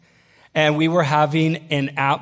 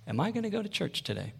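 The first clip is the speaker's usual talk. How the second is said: much softer, quick, higher-pitched musing; thinking to oneself; 3 semitones higher, 13 dB quieter, faster than usual. Retold or imitated. Student waking on Sunday morning, quietly wondering. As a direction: voice a private thought under the breath. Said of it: "thinking to myself".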